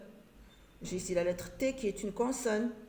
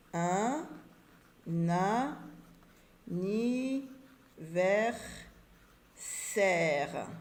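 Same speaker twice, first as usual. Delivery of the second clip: anniversaire in separate syllables with long pauses; much slower than usual. Shown as a demonstration. Teacher writing anniversaire on the board, syllable by syllable. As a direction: dictate "anniversaire" slowly, one syllable at a time.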